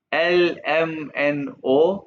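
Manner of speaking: एल एम एन ओ spelled out very slowly; slow alphabet demo. Shown as a demonstration. Teacher slowly recites letters for students. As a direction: stretch each letter एल एम एन ओ. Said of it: "गौर से सुनना है".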